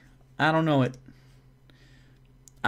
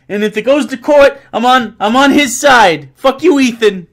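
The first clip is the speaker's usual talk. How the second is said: loud, high-pitched mock threat; yelling; 9 semitones higher, 8 dB louder than usual. Backing a dad against his son Ethan, mock-angry yell. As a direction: keep bellowing like a fed-up dad. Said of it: "I will yell at your son".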